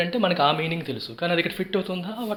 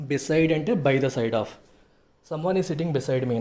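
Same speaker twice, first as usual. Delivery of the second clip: ordinary talk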